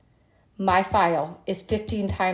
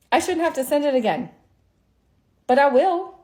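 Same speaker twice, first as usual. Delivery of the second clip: exasperated emphasis; 6 semitones higher, 4 dB louder than usual. Real, not acted. Fed-up concession in rant.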